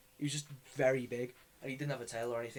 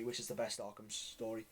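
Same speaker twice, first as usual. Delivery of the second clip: ordinary talk, nothing marked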